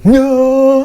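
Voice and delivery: long, high, loud wail; imitated distant wailing call. Call heard through open windows at dawn, long wail. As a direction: hold one wailing note, imitating a call to prayer. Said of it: "I just hear".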